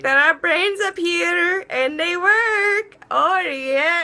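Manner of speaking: high, drawn-out mock Indian accent. Comic vlogger slipping into character, high Indian accent. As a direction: put on a slow, high-pitched Indian accent. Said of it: "that's just my Indian accent".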